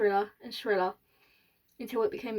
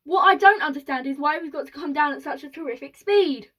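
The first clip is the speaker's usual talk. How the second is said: raised, loud questioning; puzzled boy calling out, pitched up; yelling above noise; 4 semitones higher, 6 dB louder than usual. A narrator's reading. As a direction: project it over a din. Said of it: "he yelled above the noise".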